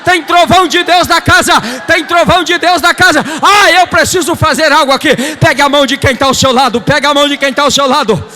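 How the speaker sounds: high, shouted revival refrain; full-force preaching. Real, not acted. Preacher announcing God's thunder in the house, full-force preaching. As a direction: hammer each repeat at the congregation.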